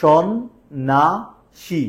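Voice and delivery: সন্ন্যাসী split into three slow beats with stops; syllable by syllable. Shown as a demonstration. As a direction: tap out সন্ন্যাসী one syllable at a time. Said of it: "কয়বার থামছি?".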